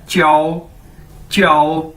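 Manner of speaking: च्याओ as "chyao", held low and even; model pronunciation. Shown as a demonstration. Teacher drilling the word for "to be called", model pronunciation. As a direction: repeat च्याओ evenly for learners. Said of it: "तो word क्या है?".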